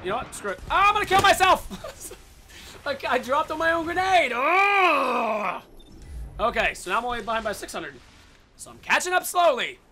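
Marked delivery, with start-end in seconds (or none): 0.7-2.1 loud, high yell of frustration; high-pitched gamer outburst; exasperated shout. Real, not acted. Blunder with own grenade. 2.9-5.6 loud, higher cry into a long "ugh"; yell, then growing groan of frustration; loud self-blaming outburst; exasperated yell. Real, not acted. Blunder with own grenade.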